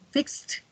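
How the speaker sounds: "fixed" as one syllable ending in t; final T. Shown as a demonstration. Teacher drills past forms, "fixed" ending in a clear T. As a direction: add a released T to close "fixed". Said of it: "Al final suena como una T".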